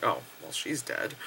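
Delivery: low, soft, matter-of-fact drop; deadpan resignation. Retold or imitated. Viewer seeing the sister arrive, deadpan. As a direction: write it off quietly in deadpan.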